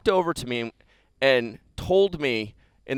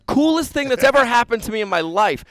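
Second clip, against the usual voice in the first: excited; 6 semitones higher, 5 dB louder than usual. Real, not acted. Thrilled to visit Eleiko.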